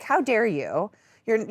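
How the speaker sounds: ordinary talk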